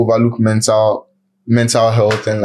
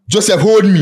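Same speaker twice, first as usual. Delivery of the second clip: pleading; 8 semitones higher than usual. Acted. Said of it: "say it one more time".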